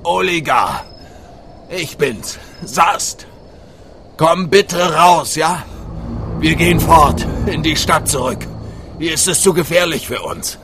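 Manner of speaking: calling out. Acted. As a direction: call out into the chapel. Ruft Oligar in der Kapelle. (laut rufend)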